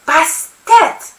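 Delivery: high, exclaimed oath; loud curse. A narrator's reading. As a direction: spit out a god's name as a curse. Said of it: "I curse loudly".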